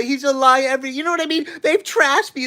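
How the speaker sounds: ordinary talk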